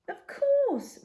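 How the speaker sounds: high, loud cheerful reply; young boy answering, high and eager; bright boy's voice. A narrator's reading.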